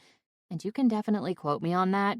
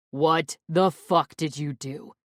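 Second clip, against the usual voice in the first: slow, louder, barely held fury; simmering rage; 5 dB louder, slower than usual. A narrator's reading. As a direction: hold the rage just under the surface. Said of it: "simmering with rage".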